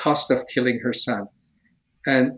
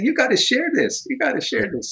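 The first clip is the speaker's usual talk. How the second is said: urging inner voice; 10 semitones higher than usual. Retold or imitated. Retelling the Holy Spirit's urging, pitched up and insistent. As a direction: press him to speak up. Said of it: "the Holy Spirit said".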